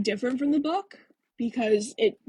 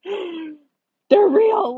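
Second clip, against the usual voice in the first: higher, louder cry of joy; thrilled disbelief; 9 semitones higher, 7 dB louder than usual. Retold or imitated. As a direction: squeal it like you just saw them move. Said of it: "I was like, gasps".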